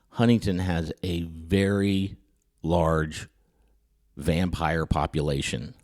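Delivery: hushed, deliberate disclosure; mock-grave revelation. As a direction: draw out the retold warning word by word. Retold grave warning about vampires.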